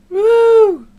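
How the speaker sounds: high, drawn-out "woo"; excited woo. Real, not acted. Co-host kicks off their franchise video, high excited woo. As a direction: give a loud, high cheer. Cheering after the video introduction.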